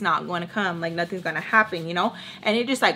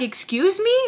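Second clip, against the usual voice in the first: much higher, indignant "Excuse me?"; offended excuse me; 10 semitones higher than usual. Retold or imitated. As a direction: take offence, pitch jumping up. Offended by "she's trouble.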